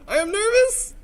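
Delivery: loud, very high pitched demo; nervous high voice. Shown as a demonstration. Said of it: "in high voice".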